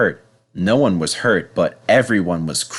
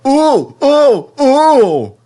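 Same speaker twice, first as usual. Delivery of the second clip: much higher, louder alarmed yelps; dismayed cries; 8 dB louder, much slower than usual. Acted. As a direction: cry out as stones hit. Henry hit by stones.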